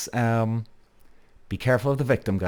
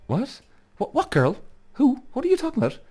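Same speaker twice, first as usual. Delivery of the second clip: incredulous. Retold or imitated. Retelling accusation, acting incredulous.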